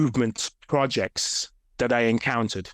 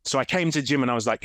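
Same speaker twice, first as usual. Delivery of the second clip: ordinary delivery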